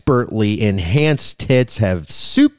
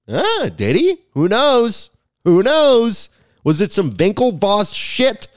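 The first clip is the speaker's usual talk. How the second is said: teasing mock wonder; 9 semitones higher, slower than usual.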